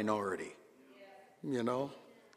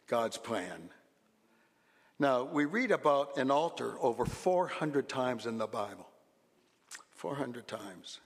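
ordinary delivery

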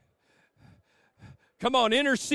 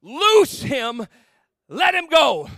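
loud, higher command ringing out; a firm call to free him; commanding cry; 4 semitones higher, 6 dB louder, much slower than usual. Acted. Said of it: "Jesus says these words".

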